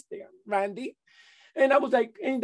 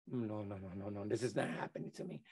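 stunned denial; 10 semitones lower, 17 dB quieter than usual. Retold or imitated. Denial on hearing dead mother.

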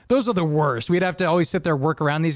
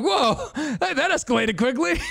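much higher, laughing through it; laughing disbelief; 9 semitones higher than usual. Retold or imitated. Retelling the client's gun threat, laughing in disbelief. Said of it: "I'm like".

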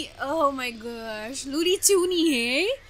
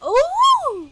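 high, loud burst; surprised oh; 12 semitones higher, 9 dB louder than usual. Real, not acted. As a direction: caught off guard. Sudden reveal.

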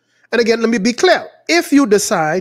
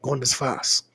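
low, soft imitation of praying; acted prayer; 6 semitones lower, 9 dB quieter than usual. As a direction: voice a humble private promise to God. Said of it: "You say".

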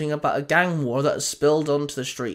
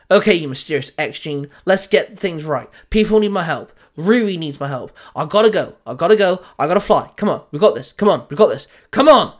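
put-on boy urging his powers to work, rising to a shout; pumping himself up; 3 semitones higher, 7 dB louder than usual. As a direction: psych yourself up, louder each time. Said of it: "Otclos muttered to himself".

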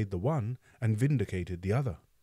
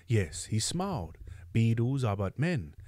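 ordinary delivery, nothing marked

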